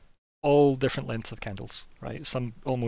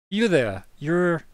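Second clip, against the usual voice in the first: librarian calls; 6 semitones higher, 8 dB louder, much slower than usual. Acted. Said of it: "she goes".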